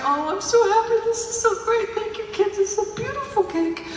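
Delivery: hammed-up, high-pitched fake delight; put-on happy mom. Crying mother thanking her kids for cake, falsely overjoyed. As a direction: gush in a high, put-on voice to cover tears. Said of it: "I always go into that voice".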